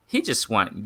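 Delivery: normal talking voice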